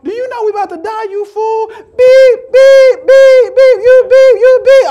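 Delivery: high mock rant bursting into loud bleeps; going off. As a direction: yell censored curses in comic outrage. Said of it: "I would have been going off".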